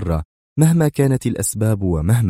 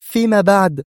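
high, louder edgy brush-off; tense high-voiced dismissal; nervous irritation; 12 semitones higher, 4 dB louder than usual. A narrator's reading. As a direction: brush them off irritably in a raised pitch. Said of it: "قائلة في عصبية".

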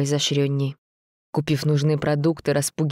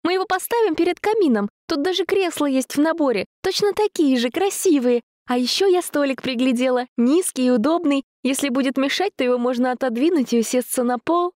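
put-on bubbly sorority girl; 11 semitones higher than usual. Dasha gushing about furniture.

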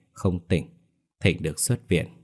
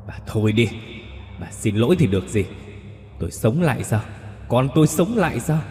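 rising, higher, anguished fury; ghost's harsh rebuke; 5 semitones higher than usual. Acted. Ghost daughter-in-law rejects the apology, furious. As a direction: spit each question at her. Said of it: "Hạnh Mỹ gằn giọng".

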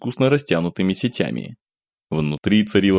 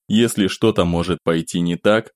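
usual speaking voice

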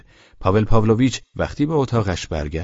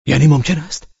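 higher, louder startled cry; cries out; 7 semitones higher, 5 dB louder than usual. Acted. Man stunned by his own thought, crying out. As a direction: blurt it out in shock. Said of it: "فریاد کشید".

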